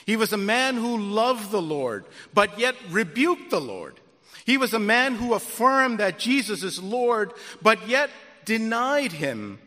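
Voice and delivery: loud, rising preacher's cadence; preaching. Acted. Pastor stirring his congregation, loud preaching. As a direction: build the contrast like a pulpit climax.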